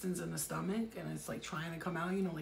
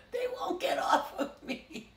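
high, slow mock sobbing; hammed-up tearful complaint; crying toilet; 9 semitones higher, 4 dB louder than usual. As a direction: whine it through laughter as a weeping toilet. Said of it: "I'm seeing a toilet crying".

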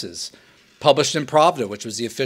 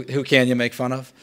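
normal talking voice

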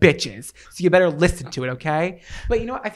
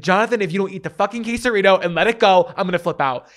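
loud, higher angry outburst; furious quote at the partner; retold snap; 5 semitones higher, 6 dB louder than usual. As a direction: yell it like you've had the worst day. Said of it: "I didn't mean to snap at you".

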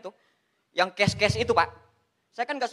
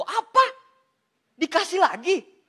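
mock outrage; 8 semitones higher, 9 dB louder, slower than usual. Feigned complaint at endless bonus.

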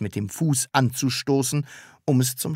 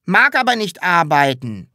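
grumbling; 5 semitones higher, 6 dB louder than usual. A narrator's reading. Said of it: "maulte das Sams".

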